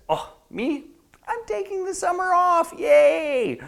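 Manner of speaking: very high, fake-gleeful boast; hammed-up carefree squeal, ending in a loud cheer; outside voice. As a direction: overdo the happy front. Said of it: "the outside voice says".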